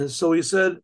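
plain reading voice

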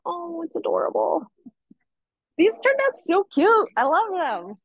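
high, delighted gushing over cute art. Real, not acted. Teacher gushing over kids' paintings.

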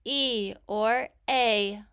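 "E or A" very slowly, each sound held apart; slow, separated letter sounds "E", "A". Shown as a demonstration. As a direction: give "E" as ee and "A" as ay, drawn out. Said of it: "I will say the sound".

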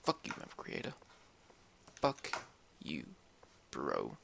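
hushed, very soft swearing; quiet cursing. Real, not acted. Gamer curses the map creator after the rant, very quietly. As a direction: curse almost under your breath.

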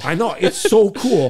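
high, loud, slowed delight; real excitement. Real, not acted. Answering the host's amazement, loud excited delight. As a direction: belt it out, thrilled.

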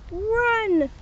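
high panicked cry of Run!; a little bear's high mock-frightened yell. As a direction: shout the warning, high and urgent. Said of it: "he yelled".

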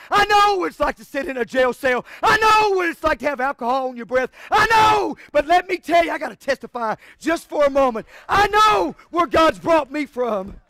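loud, rising preacher's cry; preaching hard from the heart; shouted testimony. Real, not acted. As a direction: testify at full voice.

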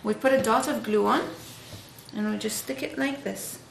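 ordinary talk, nothing marked

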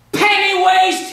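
high, loud, slow sneer; villain's taunt. Pretend. Villain Pete taunting the storyteller, high slow sneer. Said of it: "he called me".

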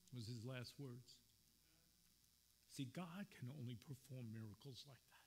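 ordinary talk, nothing marked

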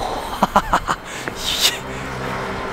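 whispery high rising giggle; squeaky high laugh. Real, not acted. Finds tree, laughs.

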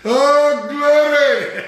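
loud, high cry of glory; ecstatic shout. Real, not acted. Preacher shouting glory to the congregation. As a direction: shout it out in joy.

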